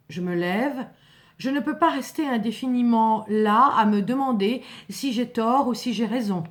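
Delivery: ordinary delivery, nothing marked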